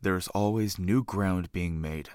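low, measured announcer read; show intro narration. Acted. Host opening the trope podcast, measured announcer narration.

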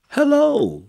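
very high, loud hello; shouted. A narrator's reading. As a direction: shout a greeting right up high. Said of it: "shouted the tortoise".

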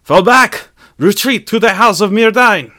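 high, very loud battlefield shout; desperate yelled order. A narrator's reading. Celebrimbor orders the failing flank to retreat, yelling at full force. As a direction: yell the command to the whole army. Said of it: "stammering for breath, yelled".

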